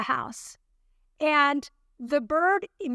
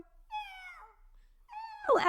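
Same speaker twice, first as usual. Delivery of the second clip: high, strained mock panic calling Phil; retold frantic yell for her husband; 7 dB quieter than usual. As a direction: call for your husband in a half-acted panic. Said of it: "screaming his name in my panic voice".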